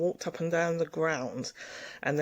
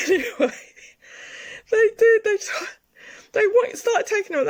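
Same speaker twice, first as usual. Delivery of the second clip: high, loud laughing speech; words squealed out over real laughter; 9 dB louder than usual. Real, not acted. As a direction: tell it through a burst of laughter. Laughs as she resumes her story.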